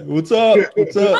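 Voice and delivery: high-pitched mock greeting; imitating celebrity viewer. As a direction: greet the chat in a high put-on voice.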